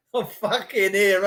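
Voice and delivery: ordinary talk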